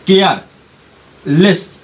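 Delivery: "Careless" broken in two with a pause before लेस; split into syllables. Shown as a demonstration. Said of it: "शब्दों को अलग अलग करना".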